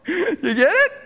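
delighted laughing check-in. Real, not acted. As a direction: giggle the question out. Laughing question to congregation.

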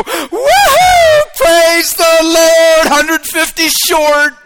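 shrieking woohoo, then a slow drawn-out praise; mock cheer. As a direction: ham up fake joy over the bills. Mock cheer over short bills.

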